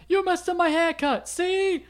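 high-pitched gangster voice; squeaky tough-guy threat, see; villain quote. Retold or imitated. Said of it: "He's like".

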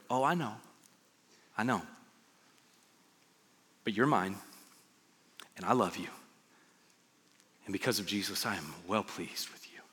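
low, soft, sinking to a near whisper; gentle reassurance. Acted. God to someone who messed up at work, gentle reassurance. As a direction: reassure softly, pausing between phrases.

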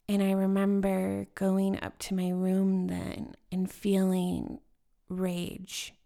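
usual speaking voice

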